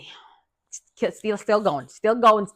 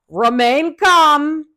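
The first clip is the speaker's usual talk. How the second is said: slow, drawn-out, higher-pitched warning; measured caution; 6 semitones higher, 5 dB louder, much slower than usual. Retold or imitated. Daughter prepares mom who freaks out, slow measured caution. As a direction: stretch each word out to pre-empt panic. Breaking alarming news to mom.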